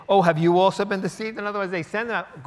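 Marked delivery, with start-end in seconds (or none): 0.1-1.4 loud, high scornful question; mock the officers for being fooled; scornful Pharisees. Pharisees scorn their officers, loud and high.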